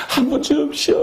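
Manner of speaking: lightly imitated beggar begging; beggar's plea. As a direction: beg like a cold beggar. Imitates shivering beggar asking.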